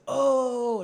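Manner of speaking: loud, high drawn-out "oh"; hammed-up waiter's reaction; mock realization. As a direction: exclaim as if it suddenly clicks. Waiter acting out sudden understanding.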